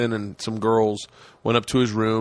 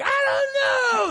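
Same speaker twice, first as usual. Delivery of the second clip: imitated strained holler; yelling over noise; much faster than usual. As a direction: shout back over a chainsaw. Retold yelling over chainsaw.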